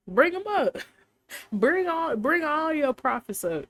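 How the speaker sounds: high-pitched, cocky call with a chuckle inside; acted summons. Elijah daring Ahab to bring the prophets, acted challenge.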